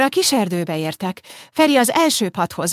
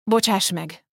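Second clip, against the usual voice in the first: usual speaking voice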